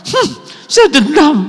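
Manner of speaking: high, loud smug "saya dendam"; mock proud grudge-holder. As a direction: ham up the self-satisfied grudge. Meniru orang yang dendam. (meniru orang sok keren karena dendam)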